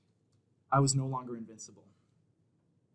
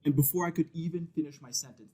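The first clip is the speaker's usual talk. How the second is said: ordinary talk, nothing marked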